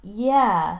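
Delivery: ordinary delivery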